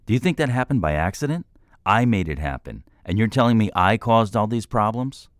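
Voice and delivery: heated, rising defence, pitch climbing; retorting. A narrator's reading. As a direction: fight back, proud and stung. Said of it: "Troy retorted".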